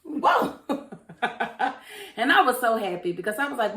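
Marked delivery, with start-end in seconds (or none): 0.0-0.6 high, loud mock-startled whoa. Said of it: "she woke up and was like". 0.6-2.1 airy laugh. Real, not acted. Laughing at plot twist.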